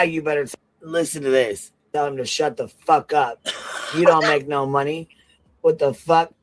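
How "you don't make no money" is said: ordinary talk, nothing marked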